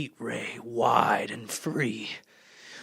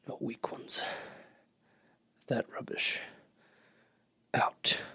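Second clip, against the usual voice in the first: whispered scorn; 3 semitones lower, 7 dB quieter than usual. Acted. Conspirator sharing secret plan.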